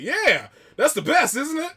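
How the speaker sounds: high, loud, drawn-out enthusiasm; imitated gleeful brother. Brother delighted at a support meeting, mock high, loud glee.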